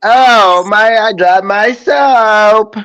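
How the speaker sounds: high, loud, slow mock dismay; mock dropped-soap victim. Playing the man who drops soap in jail, hammed-up high voice. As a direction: draw it out like a hammed-up damsel.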